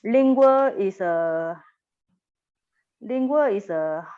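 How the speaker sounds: lingua spoken slowly, each syllable lin-gua clear; slow lecture dictation; slow careful term. Shown as a demonstration. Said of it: "next one, lingua".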